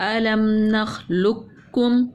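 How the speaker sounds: slow chant with doubled kaf; slow recitation. Shown as a demonstration. Teacher demonstrating the doubled letter to pupils, slowly. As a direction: hold the doubled letter in नखलुक्कुम. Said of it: "कॉफ मोटी थी लेकिन नहीं पढ़ सकते".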